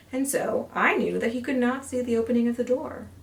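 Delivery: ordinary delivery, nothing marked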